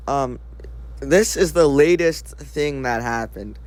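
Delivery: normal talking voice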